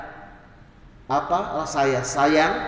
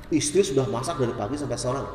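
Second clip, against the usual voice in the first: usual speaking voice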